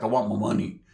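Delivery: low growled demand; gravelly voice. Retold or imitated. Toko repeating his money demand, low gravelly growl. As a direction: growl it low, like an angry ogre. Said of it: "like, a grovelly voice".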